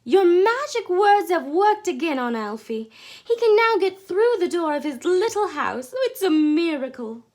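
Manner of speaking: high, loud gushing joy; thrilled gushing praise; overjoyed. A narrator's reading. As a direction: pour out her delight.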